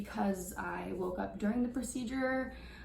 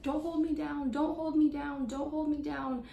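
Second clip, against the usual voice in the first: recreating a desperate scream at the staff; retold screaming; 6 semitones higher than usual. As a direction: scream it like you're being pinned down. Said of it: "I'm just screaming".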